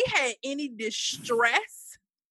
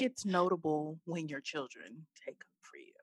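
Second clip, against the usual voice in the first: whispered aside; 6 semitones lower, 11 dB quieter than usual. Real, not acted. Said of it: "whispered remark".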